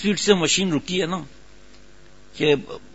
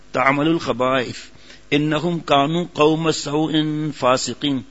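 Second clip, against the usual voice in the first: Quran recitation; slower than usual. Real, not acted. Recites Quran verse slowly.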